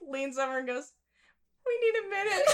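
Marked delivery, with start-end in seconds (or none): 1.7-2.5 high voice, holding back laughter; squeaky, barely composed plea; imitated laughing request. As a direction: mimic someone too giggly to order. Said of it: "both laughing too hard".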